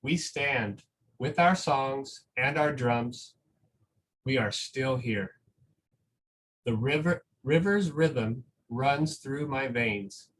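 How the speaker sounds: slow, gentle storybook reading with long pauses; reading a picture book aloud. Acted. Teacher reading a picture book to school families, slow and gentle.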